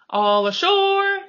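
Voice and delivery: high, loud sailors' call of all ashore; a slow, loud crew shout. A narrator's reading. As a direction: bellow it from the deck. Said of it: "the men shouted".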